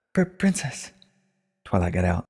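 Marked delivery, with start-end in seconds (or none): 0.1-0.9 breathless, high gasping stammer; out of breath from running up to her room; panting. A narrator's reading. Twilight gasps the title to Celestia, winded.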